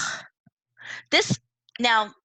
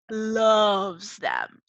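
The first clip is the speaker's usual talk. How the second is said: loves them said loud and strong; stress on loves; 4 dB louder than usual. Shown as a demonstration. Teacher stressing to the class: "loves them" loud. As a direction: punch "loves them" hard. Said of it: "she, like".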